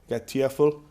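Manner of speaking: usual speaking voice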